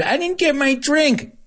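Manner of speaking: high mock complaint; whiny passenger. Rude passenger griping to flight attendant, high and whiny. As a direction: whine like an entitled flyer. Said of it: "mocking rude passenger".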